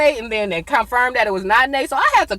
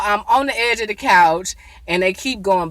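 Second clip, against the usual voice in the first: usual speaking voice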